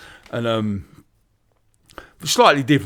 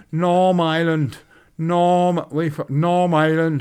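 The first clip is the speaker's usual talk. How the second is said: slow, drawn-out mock Belfast vowels; a light Northern Irish drawl; 5 semitones higher, much slower than usual. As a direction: stretch it like a Belfast local. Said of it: "That's how they pronounce it".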